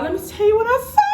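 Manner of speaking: quick, rising high on the last words; dramatic build-up. Real, not acted. Vlogger teases her discovery to viewers, dramatic and rising.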